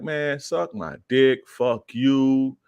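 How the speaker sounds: high, loud, drawn-out taunts; going off, retold. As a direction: act out slow, defiant cursing. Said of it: "I'm going off on him".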